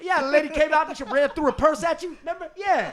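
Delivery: high, loud mocking shout; loud inmate yelling. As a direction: holler it gleefully.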